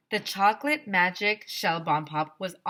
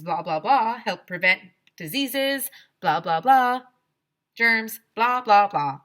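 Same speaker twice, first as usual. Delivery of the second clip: mocking blah blah; 3 semitones higher, 9 dB louder than usual. Kid mocking the droning lecture.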